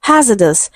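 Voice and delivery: "Hazardous" as HAZ-uh-dus with two schwas; corrected pronunciation. Shown as a demonstration. Teacher repeats for students, "Hazardous" as HAZ-uh-dus. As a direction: hit HAZ in "Hazardous" and weaken the rest. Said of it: "it is pronounced hazardous".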